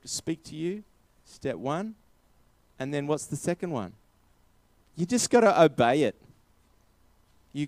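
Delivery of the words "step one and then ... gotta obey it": ordinary talk, nothing marked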